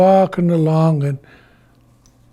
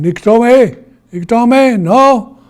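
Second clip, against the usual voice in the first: high, loud calling out; alarmed grandfather's shout; warning shout; 8 semitones higher, 8 dB louder than usual. Acted. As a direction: call sharply to stop him. Grandfather calls out to stop Iktomi.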